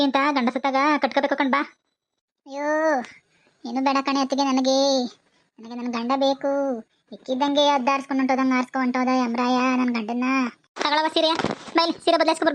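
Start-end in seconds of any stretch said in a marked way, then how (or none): 2.5-10.2 put-on wailing. Widow cries to sister-in-law that she wants her husband, fake lament. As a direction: feign inconsolable grief, stretching the words. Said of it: "ಸ್ವಲ್ಪ ಚೆನ್ನಾಗಿ drama ಮಾಡ್ಬೇಕು".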